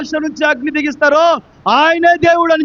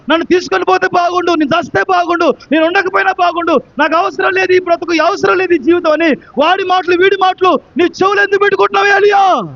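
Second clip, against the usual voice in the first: fiery preaching; 5 semitones higher than usual. Acted. Preacher rousing the congregation, in full-force preaching. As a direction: drive it home to the crowd.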